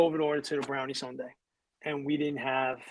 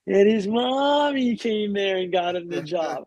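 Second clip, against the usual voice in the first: higher, slow, drawn-out MOMMY; mock teasing announcement; 5 semitones higher, 3 dB louder, slower than usual. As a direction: stretch it out like a public ribbing. Retells boss's embarrassing public story.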